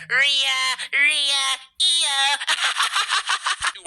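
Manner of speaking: imitating Liv Morgan yelling for her allies; mock Liv Morgan. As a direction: call out names in a whiny high voice. Mocking Liv Morgan calling out.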